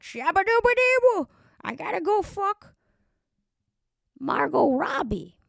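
high, loud goofy babble voice; Adam Sandler. Acted. Comic mocking a movie star's characters, high goofy impression. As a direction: blurt it out as a high-pitched comic caricature. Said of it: "his characters are all him, like".

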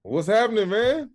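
high, loud, delighted hello; excited greeting. Real, not acted. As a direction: greet him with big joy. Guest greeting host enthusiastically.

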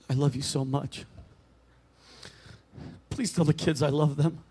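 soft, low farewell voice; a frail man saying goodbye from his deathbed; dying old man. Acted. A dying man with Alzheimer's says goodbye to his wife, soft and low.

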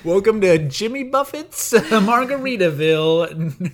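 high, loud welcome through laughter; mock welcome. Friend mocking the resort with a laughing welcome. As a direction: announce it like a giggling resort host.

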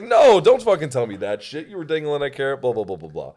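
loud, high retold telling-off; indignant retort. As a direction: act out an outraged refusal. Retold angry retort to accuser.

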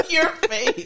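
high laugh into words. Real, not acted. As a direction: laugh while pointing out a face. Laughing at friend's face.

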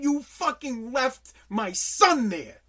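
loud, slow accusing voice of the families; slow, heavy, furious blame; grieving parents' fury. Retold or imitated. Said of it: "they get".

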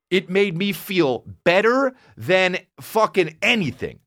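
ordinary talk, nothing marked

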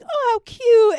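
high-pitched, hammed-up gushing; mock cooing. Bad guy seeing a little elf, mock cooing. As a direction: coo it way up high.

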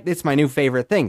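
usual speaking voice

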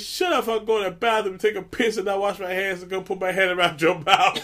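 high-pitched retold dad telling off his son; mock scolding. Dad shutting up his grown son at cards, mock scolding. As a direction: act out a comic paternal threat.

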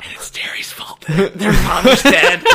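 breathy whisper broken by laughter; giggling it out in a whisper. Real, not acted. Dark joke through laughter.

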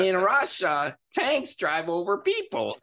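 put-on high-pitched Russian accent punchline; hammy comic Russian accent, close to laughing; Yakov Smirnoff. Comedian landing the tanks joke, Russian accent. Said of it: "sounded like a drunk Yakov Smirnoff".

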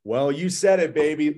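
high, loud hype for his team; fired up, cheering; excited cheer. Real, not acted.

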